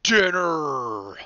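loud roaring bear; loud hungry bear roar. A narrator's reading. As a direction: roar it at your prey. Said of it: "roared the bear".

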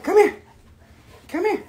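very high little coaxing call; calling a dog. Real, not acted. Owner coaxes the dog to camera, high pet call.

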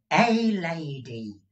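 high and slow, savouring it; old Gride. Acted. Miser savouring his toast, lightly high and slow. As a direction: linger on it like a treat.

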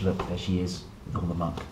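usual speaking voice

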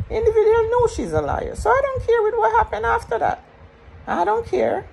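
high and loud, flat-out defiant; furious high rant. Real, not acted. Calling out the liar to viewers, furious high rant. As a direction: spit it out, done with her.

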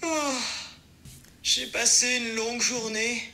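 weary sigh sliding into a slow, drowsy voice; sleepy. Shown as a demonstration. Assistant acts exhausted on request, sleepy. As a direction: drag it out, ready to fall asleep. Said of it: "ou encore endormi".

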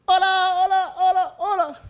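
hola crié plus haut puis répété en s'estompant; cri en écho. Shown as a demonstration. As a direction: crie hola vers la vallée comme un écho. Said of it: "jugando con el eco, mira".

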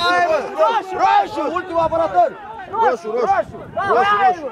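loud, high indignant shouting; demanding red. Real, not acted. Viewer yells at the referee to show red, loud and high. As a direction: yell for the red card like you mean it.

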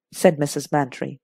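ordinary delivery, nothing marked

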